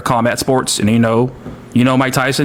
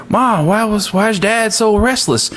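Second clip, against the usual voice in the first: higher, put-on child's voice; little kid; 7 semitones higher than usual. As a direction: ask like a puzzled little boy, pitched up. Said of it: "he's asking his mom".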